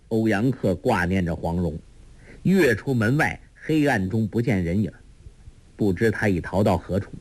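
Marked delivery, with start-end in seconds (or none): none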